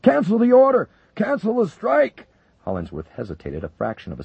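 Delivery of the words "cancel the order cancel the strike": very high, loud desperate yell; shouting. Acted. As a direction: scream to halt the launch. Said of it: "Wilkinson shouted".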